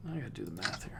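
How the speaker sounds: very soft, low, quick mumble after a sigh; barely voiced thinking aloud; muttered aside. Real, not acted. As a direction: mutter it to yourself, almost whispered. Working out the math, aside.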